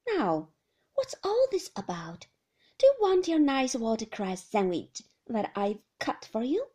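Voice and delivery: put-on a nurse humouring her patient like a child; coaxing nurse.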